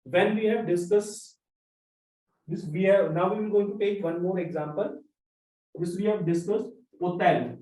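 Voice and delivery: normal talking voice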